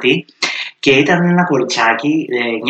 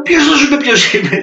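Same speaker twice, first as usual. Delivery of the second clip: much higher and louder, mock indignant comeback; playful retort; 6 dB louder than usual. Answering the grandma who won't believe him, high mock outrage. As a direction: fire the question back in comic outrage.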